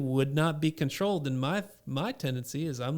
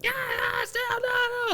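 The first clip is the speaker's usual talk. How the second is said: put-on very high shouting kid; toddler refusing; slower than usual. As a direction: shriek no like a toddler.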